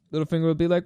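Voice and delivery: normal talking voice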